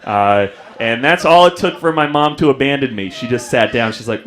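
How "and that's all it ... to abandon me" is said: ordinary delivery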